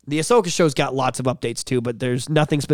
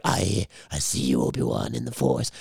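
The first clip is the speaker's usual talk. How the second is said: deep mock Vader; 9 semitones lower, 7 dB quieter than usual. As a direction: drop way down like Vader. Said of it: "be like".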